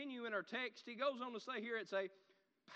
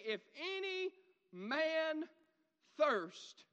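slow, high, drawn-out proclamation; crying out; 6 semitones higher, 5 dB louder, much slower than usual. Acted. Preacher quoting Jesus who stood and cried, slow high proclamation. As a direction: stretch the cry across each word. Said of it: "Jesus stood and cried, saying".

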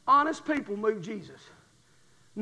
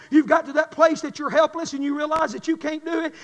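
usual speaking voice

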